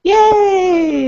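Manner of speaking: high, loud "Yay!" cheer; excited cheer. Real, not acted. Cheering the young fan.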